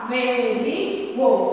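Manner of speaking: "Averiguou" drawn out slowly, syllable by syllable; slow syllable split. Shown as a demonstration. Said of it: "separação silábica".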